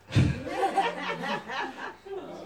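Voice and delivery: high, airy burst of laughter; a real laugh at the joke. Real, not acted. Reacting to the sound joke.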